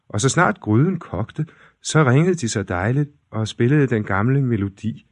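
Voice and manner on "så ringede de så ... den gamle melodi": usual speaking voice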